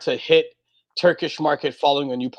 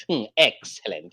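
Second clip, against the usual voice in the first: Mister Burns; 7 semitones lower, 4 dB quieter than usual. Retold or imitated. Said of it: "You guys are all trying to be Mister Burns".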